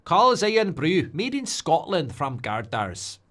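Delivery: slow, high Scottish ad voice; Scottish accent like the Irn-Bru advert. Retold or imitated. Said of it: "The only Scottish I know is the Iron Brew advert".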